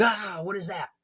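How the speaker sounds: high startled cry at the lizard; cowboy waking to a lizard, yelping in fright. Retold or imitated. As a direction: jump out of sleep, shrieking high. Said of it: "He's like".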